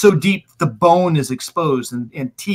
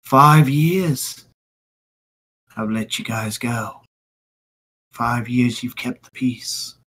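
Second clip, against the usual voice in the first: menacing villain; 2 semitones lower, 5 dB quieter than usual. Acted. Stranger threatens the crowd.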